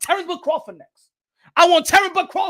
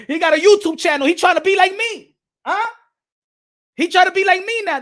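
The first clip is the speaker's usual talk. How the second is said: loud mockery; 5 semitones higher, 6 dB louder than usual. Loud mocking of rival.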